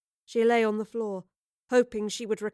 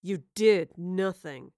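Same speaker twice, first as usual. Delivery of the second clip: taunting; 2 semitones lower, slower than usual. A narrator's reading. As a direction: needle her with slow scorn. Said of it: "he taunted".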